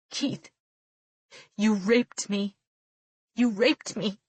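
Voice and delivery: slow, heavy accusing; broken accusation. A narrator's reading. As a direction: say it through heartbreak.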